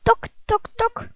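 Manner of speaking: hoog luid tokken als een kip; tok tok roepen. Acted. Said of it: "roept er iemand buiten". (iemand buiten roept als een kip: hoog tok tok)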